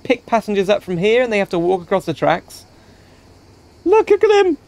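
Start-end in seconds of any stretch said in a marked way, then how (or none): 3.8-4.6 very high, delighted call; excited. Real, not acted. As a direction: point it out in glee. Laughing at dancing man.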